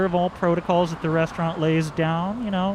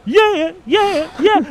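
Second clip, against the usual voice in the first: laughing yeah; 11 semitones higher, 7 dB louder, slower than usual. Pretend. Usher falling off a roof.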